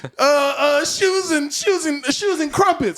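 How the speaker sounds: high, loud random name shouting; silly shouted names. Retold or imitated.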